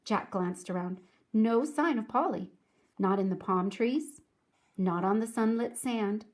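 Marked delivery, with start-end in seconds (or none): none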